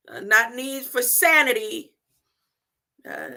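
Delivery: ordinary talk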